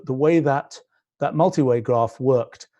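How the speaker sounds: ordinary talk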